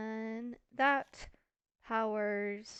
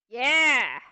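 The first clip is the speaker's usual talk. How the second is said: sudden triumphant yell; loud cheer; 14 dB louder than usual. Real, not acted. Gamer celebrating as the redstone works, loud cheer. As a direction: burst out with a very loud yeah. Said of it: "Sorry, that was loud".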